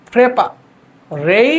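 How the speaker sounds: ফ্রেপা then রেই, each on its own; modelled syllables. Shown as a demonstration. Teacher tells students to repeat, ফ্রেপা, রেই in separate chunks. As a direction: pause clearly between ফ্রেপা and রেই. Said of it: "দেখেন, বলেন".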